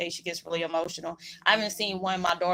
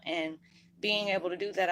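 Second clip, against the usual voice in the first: ordinary talk, nothing marked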